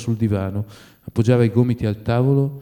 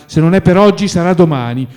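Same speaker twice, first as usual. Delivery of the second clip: raised, higher voice; loud, pleading persistence; urgent insistence; 7 semitones higher, 8 dB louder than usual. A narrator's reading. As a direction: press him not to refuse. Friend pleading not to be sent away.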